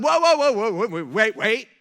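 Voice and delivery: loud, high startled protest; acted stunned angel, voice jumping up; alarmed disbelief. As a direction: halt someone in shock, stumbling over words. Acted angels stunned by God's plan.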